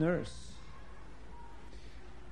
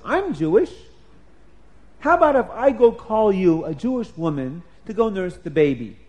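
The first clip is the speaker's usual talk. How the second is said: eager offer; 8 dB louder than usual. Acted. Girl eagerly offers help.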